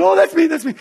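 put-on high, eager girl voice calling out. Imitating the girl at the youth meeting, a high eager call. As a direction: mimic the girl's high excited answer. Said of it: "She said".

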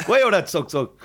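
shooing her off. Retold or imitated. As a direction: wave her away with words. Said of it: "I was like".

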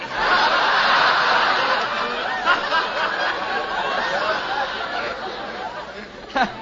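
high unvoiced laughter fit; cracking up, can't stop laughing; helpless laughter. Real, not acted. Guest breaks up at her own ad-lib, laughing.

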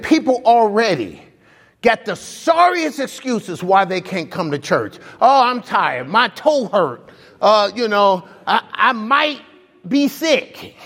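high whiny mock of lame excuses. Pastor mocking churchgoers' excuses, high and whiny. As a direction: lampoon the people dodging church.

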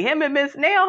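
normal talking voice

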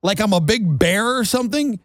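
ordinary delivery

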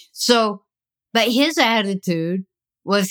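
ordinary talk

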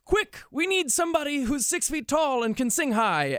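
high, put-on voice of the producers; casting call voice. Producers begging for a tall tenor, high put-on voice. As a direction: squeal it like a frantic casting office. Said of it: "they were like".

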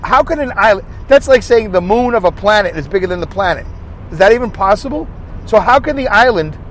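ordinary delivery

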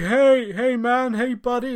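high, loud acted hail; mock shout after a passer-by; calling out. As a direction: call over to someone to get their attention. Acting out a man calling out.

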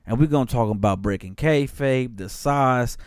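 ordinary delivery

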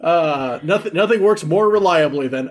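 usual speaking voice

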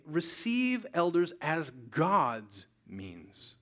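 slow, each word weighed; slow emphatic statement. Shown as a demonstration. Preacher giving the congregation the key phrase, slowly. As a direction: lay it down slowly and deliberately. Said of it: "saying it this way today".

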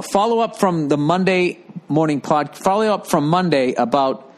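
ordinary talk

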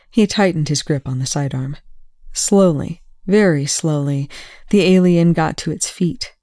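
usual speaking voice

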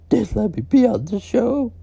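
shrieking high and loud, voice cracking; screaming breakdown. Acted. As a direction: wail it out, falling apart. Breakdown yelling over the story.

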